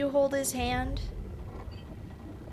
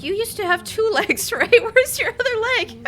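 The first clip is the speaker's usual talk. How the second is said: laughing quote; 11 semitones higher, 7 dB louder than usual. Retold or imitated. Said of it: "I was like".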